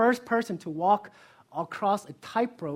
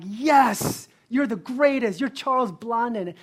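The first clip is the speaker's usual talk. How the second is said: cheering crowd; 5 semitones higher, 9 dB louder, slower than usual. Retold or imitated. Crowd cheering the tightrope walker.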